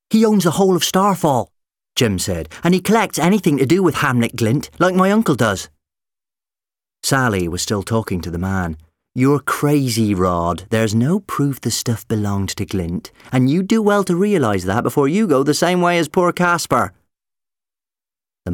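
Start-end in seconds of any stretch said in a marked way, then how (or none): 0.1-1.5 high, loud youngster; eager boy. Acted. Voices eager boy in story. 2.6-5.7 high, loud youngster; eager boy. Acted. Voices eager boy in story. 9.1-16.9 exasperated rebuke; scolding. Acted. Woman scolds old man in story.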